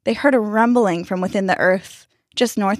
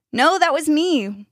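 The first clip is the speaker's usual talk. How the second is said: put-on higher, drawn-out boyish protest; indignant correction; 9 semitones higher, slower than usual. A narrator's reading. Boy's head correcting Danny, indignant. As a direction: correct them, stretching it out. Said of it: "one of the boys' heads corrected".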